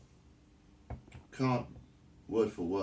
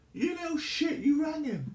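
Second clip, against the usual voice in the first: much higher, shrill-free imitation of a sister snapping at her brother; mock outrage, pitched way up; mock furious scold; 4 dB louder than usual. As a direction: play the outraged big sister catching him out. Suki scolding her brother, overheard.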